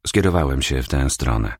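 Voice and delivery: plain reading voice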